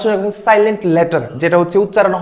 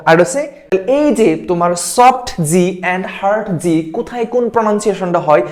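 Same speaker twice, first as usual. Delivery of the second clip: normal talking voice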